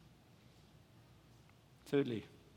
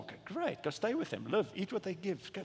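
usual speaking voice

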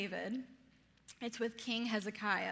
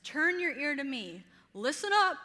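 raised, insistent call to be heard; higher, louder, pressing cry for attention; urgent plea; 5 semitones higher, 6 dB louder than usual. Acted. Preacher voicing the psalmist's cry to the Lord, urgent plea.

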